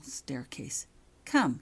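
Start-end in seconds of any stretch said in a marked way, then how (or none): 1.2-1.6 firm louder order; crisp command. A narrator's reading. Professor orders girl to follow, commanding. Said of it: "he commanded".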